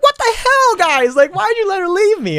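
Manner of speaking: very high squeaky dog voice; high indignant dog voice; dog's indignant voice. Retold or imitated. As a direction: whine like a left-behind poodle. Voicing abandoned dog.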